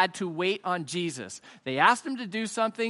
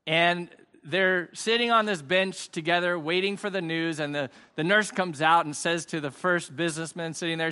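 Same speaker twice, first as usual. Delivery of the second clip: usual speaking voice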